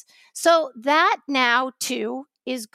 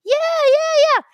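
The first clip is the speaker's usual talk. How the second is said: high, loud sing-song yeah; hammed-up chant; mock protester cheer; 6 dB louder, slower than usual. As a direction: mimic dancing protesters cheering. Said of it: "dancing and".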